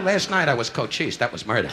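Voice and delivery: ordinary talk, nothing marked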